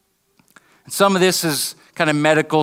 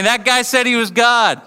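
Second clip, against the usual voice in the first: high-pitched mock jeer; 8 semitones higher, 4 dB louder than usual. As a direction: heckle from the crowd, voice raised. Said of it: "Imagine the scene of people jeering".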